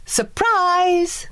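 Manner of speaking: high, bright group cheer; spring the surprise, high; high held cheer of surprise; cheerful shout. A narrator's reading. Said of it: "the fairies shouted".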